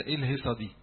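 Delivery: ordinary talk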